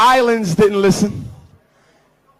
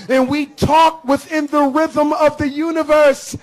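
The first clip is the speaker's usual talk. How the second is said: ordinary delivery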